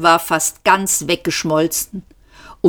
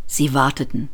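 ordinary delivery

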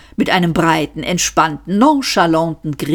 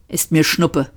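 frosty. A narrator's reading. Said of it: "sagte Arthur frostig".

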